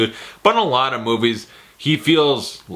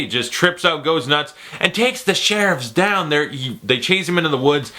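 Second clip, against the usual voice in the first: ordinary talk, nothing marked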